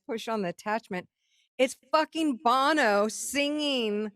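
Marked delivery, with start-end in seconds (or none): 1.6-4.1 high, drawn-out exclamation; excited reveal. Retold or imitated. Storyteller revealing [name]'s recording to the audience, excited reveal. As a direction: relish every word of the big reveal.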